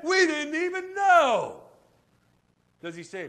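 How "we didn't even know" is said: shouted reply. Retold or imitated.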